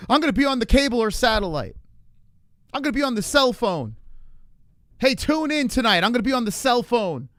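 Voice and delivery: high, loud mocking announcer voice; mocking impression. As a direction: ham up a stiff announcer. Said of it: "who talks like that?".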